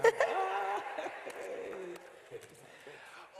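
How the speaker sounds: big high burst of laughter; loud laugh. Real, not acted. Preacher laughing hard at his own tease, loud high laugh.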